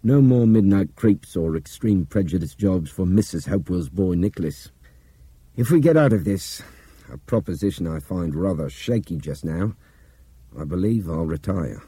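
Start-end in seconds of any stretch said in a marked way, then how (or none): 0.0-6.6 raised, gruff man's voice; man's character voice. Acted. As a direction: read Nick with bold resolve. Nick character voice, loud.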